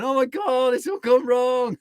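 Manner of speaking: high, loud mock wail of an old grump; hammed-up grumpy old man wailing at the world. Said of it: "There's this grumpy old man going".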